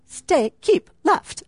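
a hint of a British sat-nav voice, slow; slow, half-hearted British accent on "keep left"; British GPS voice. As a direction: lightly mimic a British GPS telling her to keep left. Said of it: "We have the little British accent. I can't do it very well".

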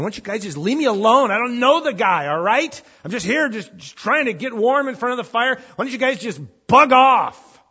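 loud, high-pitched, exasperated outburst; upset yelling. Acted. Peter denies knowing the man by the fire, upset yelling. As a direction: yell it like you want to be left alone. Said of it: "So he's yelling. He's, he's, he's, he's upset".

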